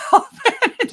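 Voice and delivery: quick high laugh; loud high laugh. Real, not acted. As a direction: bursts out laughing at the irony. Laughs about free SEO.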